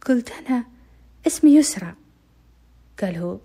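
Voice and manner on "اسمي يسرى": defiant answer. Retold or imitated. Girl answers loudly.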